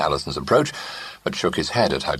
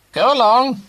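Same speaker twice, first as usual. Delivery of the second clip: high, louder shooing command; commanding dismissal; 8 dB louder than usual. A narrator's reading. Great-aunt dismissing the pair, loud and firm. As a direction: shoo them off firmly.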